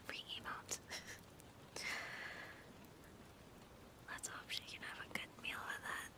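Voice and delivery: hushed, fully whispered stream chat; ASMR whisper. Real, not acted. Streamer thanks viewers and explains the script's backstory, ASMR whisper. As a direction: whisper to the listeners throughout.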